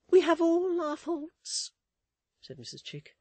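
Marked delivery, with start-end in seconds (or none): 0.1-1.7 high, loud, slow wail; hammed-up tearful matron voice; weeping. A narrator's reading. Mrs Chick laments to Miss Tox, acted weeping. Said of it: "weeping and shaking her head".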